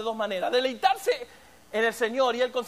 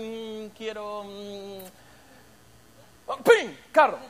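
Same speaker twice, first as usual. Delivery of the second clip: mock wishing; 5 semitones lower, much slower than usual. Believer wishing for a car, hummed then pinged.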